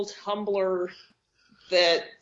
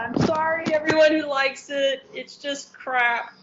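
mock apology; 6 semitones higher than usual. Real, not acted. Apologising to fans of a show, high-pitched and unrepentant. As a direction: plead sorry while trashing it.

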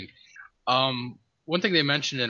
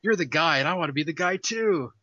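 higher, eager cartoon character voice; Homestar Runner; 7 semitones higher than usual. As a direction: play an eager cartoon hopeful. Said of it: "Homestar walks on screen. He's like".

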